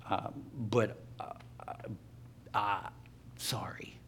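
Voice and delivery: low, soft, halting apology; quiet awkward apology. Retold or imitated. Speaker apologizing to a man he upset, quiet and hesitant. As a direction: apologize softly, fading to near-whisper on the last word.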